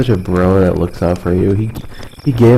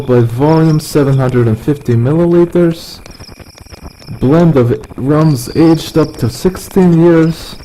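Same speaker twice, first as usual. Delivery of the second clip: slow, higher-pitched mock Russian accent reading the label; 5 semitones higher than usual. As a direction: read the rum bottle like a tipsy Russian. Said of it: "I become Russian when I drink".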